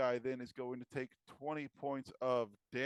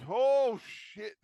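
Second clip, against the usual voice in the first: startled outburst; 10 semitones higher, 9 dB louder than usual. Acted. DM voices player's realization.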